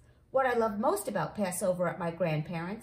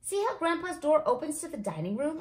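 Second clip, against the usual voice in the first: put-on eager child, voice lifted high; high-pitched, keen excitement; excited; 6 semitones higher than usual. A narrator's reading. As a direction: share your bright idea eagerly. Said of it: "I say excitedly".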